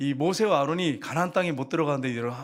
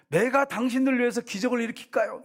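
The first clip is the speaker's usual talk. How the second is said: higher, arrogant offer; mocking boast; 8 semitones higher than usual. Pastor mocking himself offering miracles, arrogant. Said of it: "여러분 제가".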